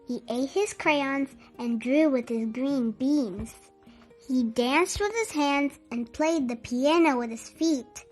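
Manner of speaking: ordinary delivery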